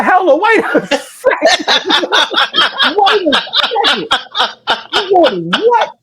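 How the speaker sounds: laughing disbelief. Retold or imitated. Retelling shock at friend's news.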